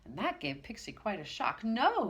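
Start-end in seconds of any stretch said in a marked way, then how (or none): 1.6-2.1 high, loud cry; shouted. Acted. Pixie shouts to save his wand. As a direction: cry out to stop him. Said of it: "he shouted".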